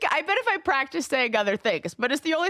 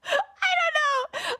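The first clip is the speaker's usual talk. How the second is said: laughing; much slower than usual. Real, not acted. Embarrassed laughing reply.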